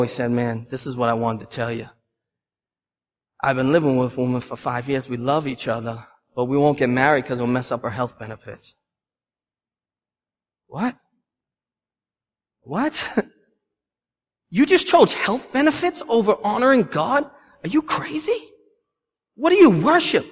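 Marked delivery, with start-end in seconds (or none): 0.3-8.7 hushed, low-pitched retold confession; real low. Man confiding in the pastor about living together, real low. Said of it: "he got real low". 10.7-20.2 high-pitched disbelief, held back in volume; incredulous. Acted. Pastor reacting to health benefits over God, incredulous. Mock-shocked reply to the confession.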